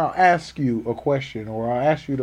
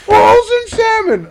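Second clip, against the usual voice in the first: slow, high, loud mock demand; yelling customer; 7 dB louder, slower than usual. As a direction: bellow it as the stubborn customer. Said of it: "He's yelling".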